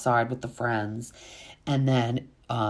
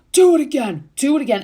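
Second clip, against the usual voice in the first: higher, louder taunt; mock taunt; 12 semitones higher, 7 dB louder, faster than usual. JT taunting Austin after the shove, imitated loud dare. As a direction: dare him to go again.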